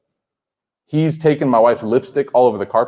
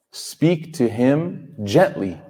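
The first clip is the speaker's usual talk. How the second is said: very slow, measured verse quote; slow, deliberate emphasis; much slower than usual. Shown as a demonstration. Preacher repeats the verse to the congregation, slowly and deliberately. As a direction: spell it out slowly, word by word.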